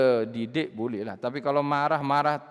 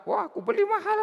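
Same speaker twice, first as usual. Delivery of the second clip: high squealed complaint over the cup; mock upset owner. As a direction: squeal about the costly cup. (pemilik cawan mengeluh nyaring)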